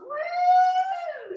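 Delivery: high swooping whoop; excited woo. Real, not acted. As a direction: whoop as the arms swing round. Swooping arms, cheering.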